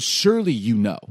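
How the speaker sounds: loud sarcastic jab; God. Acted. God needling Job, loud sarcastic jab. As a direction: hit it hard, feigning certainty he knows. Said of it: "almost like God's getting sarcastic".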